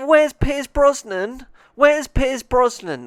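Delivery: high, slow whining; whiny complaint. Retold or imitated. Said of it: "'cause I was like".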